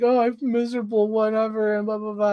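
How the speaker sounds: high mock-miserable moan; whiny sick friend. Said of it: "and she's like".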